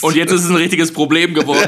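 laughing while teasing. Real, not acted. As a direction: say it through laughter.

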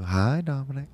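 sweet, high-pitched grandmother's greeting; sweetest voice. Retold or imitated. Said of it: "just in the sweetest voice".

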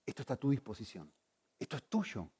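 softly voiced divine offer; God's gentle offer. God showing the believer something different, gentle offer. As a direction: offer it quietly, as if confiding. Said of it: "Y decirme".

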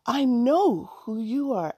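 high, bursting with recognition; anxious excitement. A narrator's reading. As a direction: blurt it as if jumping up and down. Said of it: "Allison said anxiously while jumping up and down".

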